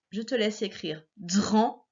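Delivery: ordinary talk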